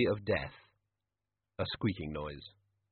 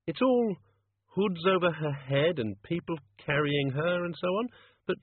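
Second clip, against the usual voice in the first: lightly voiced, higher-pitched character; higher voice, puzzling aloud; puzzled Susan; 7 semitones higher than usual. A narrator's reading. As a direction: piece it together while reading. Narrator voices Susan reading aloud.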